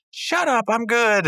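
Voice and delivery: very high cartoon snapping back; mock defensive retort. Rigby defending his charades skills, high indignant retort. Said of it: "Rigby goes".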